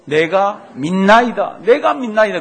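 a loud retold cry, slow; mock cry out. Father crying to Jesus for his son, loud retold plea. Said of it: "소리를 막 질렀습니다".

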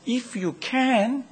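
"can" lifted and drawn out very slowly; stress on can. Retold or imitated. Preacher repeating Jesus' words, slow rising "can". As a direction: linger on "If you can" rising.

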